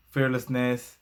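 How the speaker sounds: ordinary delivery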